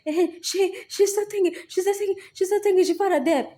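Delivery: high mocking sing-song voice; mocking the whispering voices. Mocking the voices that whisper he's still alive, high taunting voice. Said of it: "them voices come and tell, whispering, whispering".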